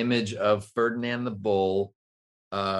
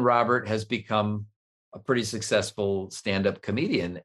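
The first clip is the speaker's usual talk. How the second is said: ordinary talk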